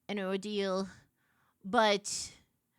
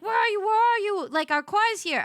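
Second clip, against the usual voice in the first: high, loud hurried calling; impatient urgency; 9 semitones higher, 6 dB louder than usual. Retold or imitated. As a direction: hurry her up, car's waiting. Sister impatient, car waiting.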